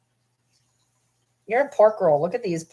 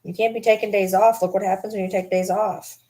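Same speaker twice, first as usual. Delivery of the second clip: ordinary talk, nothing marked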